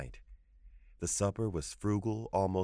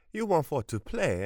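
high, loud mock echo of the plea; mocking grandmother; 9 semitones higher, 4 dB louder than usual. A narrator's reading. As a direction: throw his words back at him.

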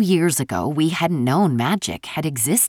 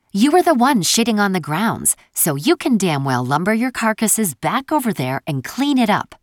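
angry scolding; 6 semitones higher than usual. A narrator's reading. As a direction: chew them out like a furious boss. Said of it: "Elise snarled".

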